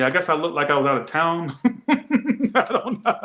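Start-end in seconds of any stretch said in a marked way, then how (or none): 1.6-3.3 high, slow laughing words; real laughter dissolving the words. Real, not acted. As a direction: laugh through it, then a breathy laugh. Laughing at the story.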